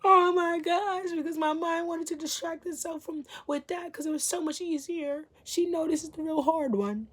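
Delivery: high laughing mock voice of her own panic. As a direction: laugh it out in a squeaky self-mocking voice.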